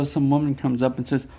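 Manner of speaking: ordinary delivery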